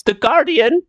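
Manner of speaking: high servant voice; Micah. Acted. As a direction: finish the report in a high put-on voice. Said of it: "Micah knocks on the".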